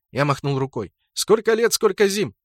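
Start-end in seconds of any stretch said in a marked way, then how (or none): 1.1-2.3 high, louder hearty hail; exclaimed greeting. A narrator's reading. Narrator hails the orc he once knew, exclaimed greeting. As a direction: hail an old acquaintance, pitched up. Hailing an old acquaintance.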